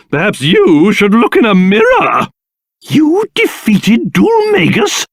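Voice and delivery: normal talking voice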